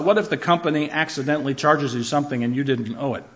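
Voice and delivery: ordinary delivery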